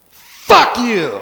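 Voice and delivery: sudden loud snap; loud cat voice, curt; cat's blunt curse. Acted. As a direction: bark it at the owner. Cat voiced staring at owner.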